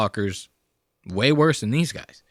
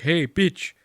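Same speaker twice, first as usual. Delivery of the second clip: higher, mock foreign-accented pickup voice; quiet come-on in a put-on accent; imitating Ari; 4 semitones higher, 4 dB quieter than usual. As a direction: play a clumsy foreign suitor. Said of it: "And then he's like".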